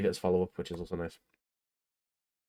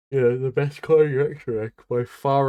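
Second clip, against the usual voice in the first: yawning mid-sentence; 5 semitones higher, 6 dB louder than usual. Real, not acted. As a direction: talk through a yawn. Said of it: "I am sorry I'm yawning".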